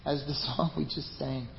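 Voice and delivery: soft, hushed pastor's prayer; quiet prayer. Acted. Pastor closing the sermon with the congregation, in quiet prayer. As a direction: pray gently over the church.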